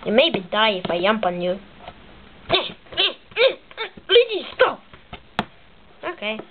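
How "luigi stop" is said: high, urgent plea; high-pitched protest; alarmed cry. Acted. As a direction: cry out to make him stop. Mario begging Luigi to stop.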